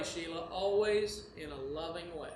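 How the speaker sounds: ordinary talk, nothing marked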